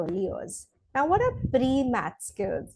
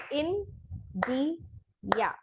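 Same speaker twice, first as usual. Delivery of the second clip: India said slowly in three beats, In-di-a; clapped syllables; 3 semitones higher, 7 dB quieter than usual. Shown as a demonstration. Teacher to class, counting syllables: slow India in three beats. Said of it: "I'm gonna clap three times for India".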